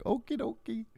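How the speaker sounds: the army meekly giving in in a tiny high voice; mock meek military. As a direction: squeak out a limp surrender. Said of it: "Military was like".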